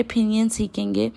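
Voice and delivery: usual speaking voice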